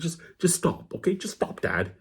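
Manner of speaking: ordinary talk, nothing marked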